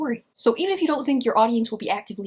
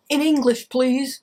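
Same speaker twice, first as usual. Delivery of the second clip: firm, louder demand; tough soldier; 4 dB louder, slower than usual. Retold or imitated. Tough soldier demanding plain words from a hacker, firm. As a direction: bark it at the nerd. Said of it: "tough soldier talking to a computer hacker".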